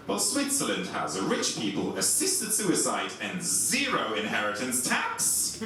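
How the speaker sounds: ordinary talk, nothing marked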